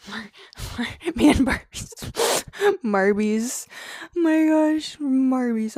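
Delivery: loud, high giggling through the words; laughing hard. Real, not acted. Reacting to a viewer's joke word, laughing loudly. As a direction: crack up over the silly word. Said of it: "I'm crying".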